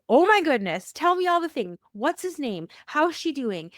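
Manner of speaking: high, loud eager gush; bursting to hear everything; retelling her own high, loud excited rush of questions; eager excitement. Retold or imitated. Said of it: "I wanted to know all the things".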